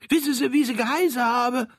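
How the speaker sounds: quick high questioning; high eager Saxon, fast. Acted. Troll quickly asks Frank about the children, high.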